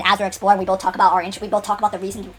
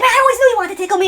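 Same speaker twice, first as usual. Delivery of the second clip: high and louder, a playful mock accusation; Cal's teasing voice; 12 semitones higher, 6 dB louder than usual. Cal teasing the player after the tickle, high playful voice. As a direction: voice Cal ribbing his friend.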